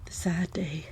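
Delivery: very soft, whispered aside of pretend sorrow; mock-sad whisper. Co-host dreading the day of leaving, mock-sad whisper. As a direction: whisper it like a tiny lament.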